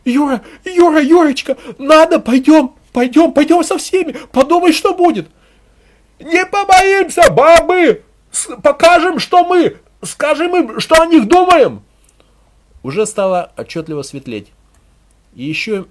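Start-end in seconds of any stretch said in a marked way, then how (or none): 0.1-5.3 high, loud urgent pleading; insistent high wifely begging; anxious female voice. A narrator's reading. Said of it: "и тут же женский". 6.2-11.8 very high, loud yelling; frenzied female ringleader's shout; woman's rallying cry. A narrator's reading.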